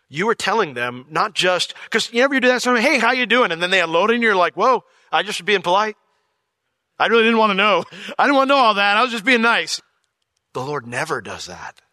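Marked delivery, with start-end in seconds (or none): 2.8-3.5 high, loud breezy hello; mock breezy greeting from a casual acquaintance; chirpy polite greeting. 4.5-9.8 high, loud flustered backpedal; hammed-up panic at too much info; high, loud mock dismay at someone oversharing; mock overwhelmed. Acting the overwhelmed polite greeter.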